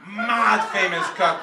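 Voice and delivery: drawn-out roadman punchline; loud roadman accent. Acted. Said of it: "in the roadman accent".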